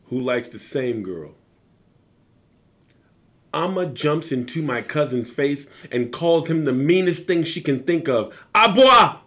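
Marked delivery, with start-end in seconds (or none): 3.5-8.4 a lively, dramatic read-aloud of a novel; animated narration, louder than his chat; story reading. Acted. Author reads passage from his book. 8.6-9.2 high, loud yell of a name-calling; one sharp shouted word; shouted insult. Acted. Girl hurls an insult at bully.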